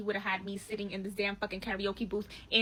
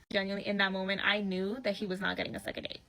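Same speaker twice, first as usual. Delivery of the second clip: usual speaking voice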